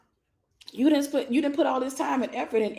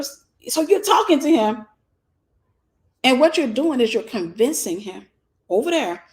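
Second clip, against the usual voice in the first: ordinary delivery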